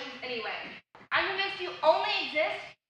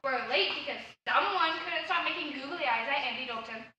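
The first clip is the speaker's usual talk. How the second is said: usual speaking voice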